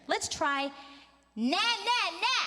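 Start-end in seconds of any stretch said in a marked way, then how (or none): 1.4-2.4 high, edgy "na" exercise; bratty little taunting na-na-na; pitched-up bratty vocal drill; bratty nagging sound. Shown as a demonstration. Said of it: "Did you do the bratty voice".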